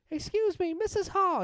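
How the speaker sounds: put-on high kid voice with a Delco accent; young boy doing a survey, Delco accent. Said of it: "my Delco accent".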